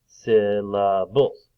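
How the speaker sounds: "syllables" slow and loud, part by part; split into syllables. Shown as a demonstration. Teacher counting parts for children, "syllables" slowly split. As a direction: beat out every part of "syllables". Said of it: "clap out the different parts".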